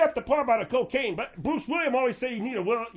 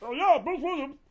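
Bruce Williams impression; 3 semitones higher than usual. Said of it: "pretend like I'm Bruce Williams".

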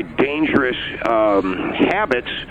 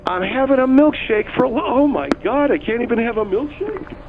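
exasperated; 7 semitones higher than usual. Real, not acted. Exasperated at being mocked.

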